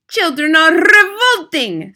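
a high, loud, slow scream. Acted. Grand High Witch screaming at her witches, loud. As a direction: shriek it out like a hateful witch. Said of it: "screamed the Grand High Witch".

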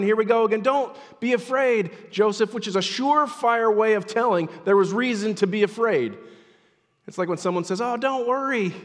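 high, loud pulpit delivery; fervent, emphatic sermon voice; impassioned preaching. Acted. Preaches at full force.

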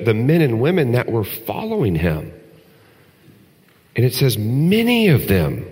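preacher stressing that disciples turned away; emphatic preaching. Acted. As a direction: lean hard on each phrase.

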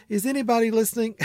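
high-pitched, quick mock plea; plaintive wondering. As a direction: call out as if unsure anyone is there. Voicing his private doubt aloud.

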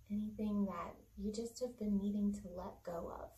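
unhurried meditation voice with long pauses; calm body-scan narration, leaving room to breathe; guided meditation. Acted. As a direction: guide listeners gently through the body.

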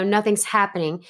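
ordinary talk

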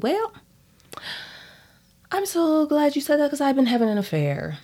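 mock husband. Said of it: "he's just basically like".

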